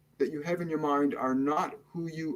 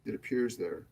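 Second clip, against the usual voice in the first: guided meditation; 4 dB quieter than usual. Acted. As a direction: ease them into calm, unhurried and hushed. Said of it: "we're going to start the meditation now".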